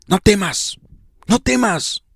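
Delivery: high, soft, soothing hush; calm a scared child; Jesus calming you in the storm, softly; tender Jesus. Acted. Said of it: "palabras que Jesucristo va a hablar".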